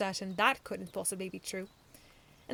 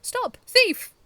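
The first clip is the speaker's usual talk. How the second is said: high, loud yell after a thief; shout; 17 dB louder than usual. A narrator's reading. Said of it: "the Rubber Robo shouted".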